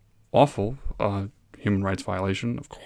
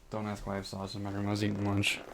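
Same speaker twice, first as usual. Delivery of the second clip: usual speaking voice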